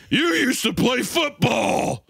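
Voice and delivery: high, drawn-out hammed-up yell; mock shout. Teasing Cole about football, high mock shout. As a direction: holler it high and slow at a squadmate. Shouted parody of game dialogue.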